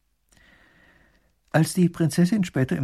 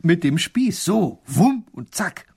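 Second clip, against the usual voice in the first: high, loud, thrilled telling with sound effects; husband thrilling his wife with the tale, high and loud; excited retelling; 6 semitones higher, 6 dB louder, slower than usual. Acted. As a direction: act out the fight breathlessly. Husband acts out the dragon fight.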